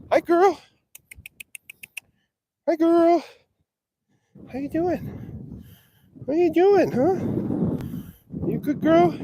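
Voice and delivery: high sweet pet-talk. Real, not acted. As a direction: coo at her like a pet. Cooing to a favourite cow.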